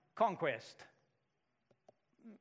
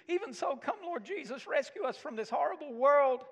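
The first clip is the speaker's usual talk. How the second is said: pleading prayer pitched much higher; hammed-up plea to be taken away; mock fatalistic prayer; 8 semitones higher than usual. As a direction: mimic a weary believer begging to be rescued. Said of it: "live with this fatalistic view".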